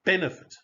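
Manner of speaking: BENEFIT with a high BEN; first-syllable stress. Shown as a demonstration. Teacher models a longer word, BENEFIT as BEN-e-fit. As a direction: punch BEN in BENEFIT, light ending. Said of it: "no stress on that".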